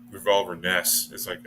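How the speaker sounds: ordinary talk, nothing marked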